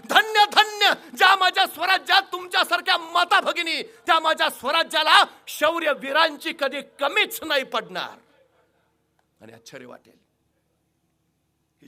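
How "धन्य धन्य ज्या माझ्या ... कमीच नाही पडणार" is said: rousing shout. Acted. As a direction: proclaim it to the whole kingdom. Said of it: "ताडकन उठले महाराज". (उत्साहाने दिलेली गर्जना)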